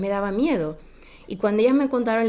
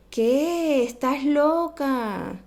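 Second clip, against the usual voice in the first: mock disbelief; 6 semitones higher, much slower than usual. Friends mock her story.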